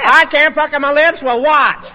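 indignant shout. Acted. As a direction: fire back loudly at the insult.

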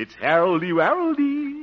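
high, cutesy pet-name call; playful calling. Acted. Harold announces himself to Theodora, high playful call. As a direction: call it out high and silly to a sweetheart.

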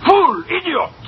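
high-pitched exasperated name-calling; theatrical scolding outburst; berating. Acted. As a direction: fling each insult separately at a useless underling. Boss berates his cashier again.

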